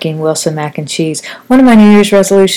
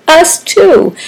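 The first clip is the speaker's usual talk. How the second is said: high rueful quip; 10 semitones higher than usual. Retold or imitated. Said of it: "I'm like".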